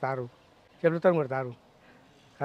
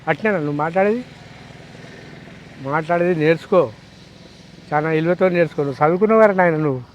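angry shouting; 7 dB louder than usual. Real, not acted. A farmer yelling at a politician over how he talks, furious. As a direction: shout him down with real anger.

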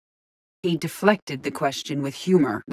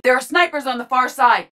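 higher-pitched battle call-out; urgent sniper warning; 7 semitones higher, 4 dB louder than usual. Acted. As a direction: alert the squad to danger.

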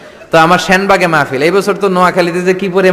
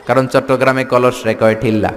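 ordinary talk, nothing marked

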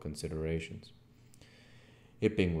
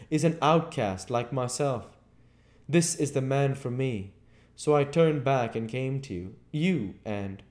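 raised, emphatic voice telling his plight; higher, louder, urgent confession; intense Invisible Man; 8 semitones higher, 7 dB louder than usual. A narrator's reading. Invisible stranger explains his need to Marvel, intense and urgent.

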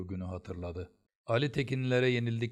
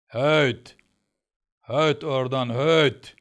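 roaring, much louder and higher; furious shout; 4 semitones higher, 12 dB louder, much slower than usual. A narrator's reading. Teacher roars at the boy in rage. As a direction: bellow at the boy to shoo him. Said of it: "diye bağırdı".

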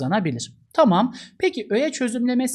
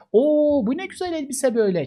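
high, delighted gasp of admiration; an Oo that marvels at the dress; admiring exclamation; 7 semitones higher than usual. Shown as a demonstration. Teacher demos a man admiring a dress, delighted. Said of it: "adam beğenmiş".

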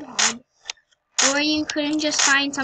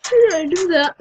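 surprised; 5 semitones higher, 9 dB louder than usual. Real, not acted. Surprised at own video.

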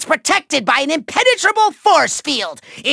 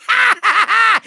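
evil cackle; 4 semitones higher than usual. Acted. Villain mocking the trapped Skylander, gloating cackle. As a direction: cackle in triumph.

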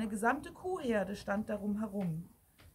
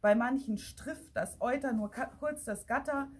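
ordinary delivery, nothing marked

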